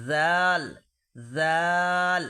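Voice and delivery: জাল loud and drawn out as "dhaal"; Arabic জাল with buzzing th of "this"; correct dhal. Shown as a demonstration. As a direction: stretch জাল, tongue between teeth. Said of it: "কি বলবো আমরা?".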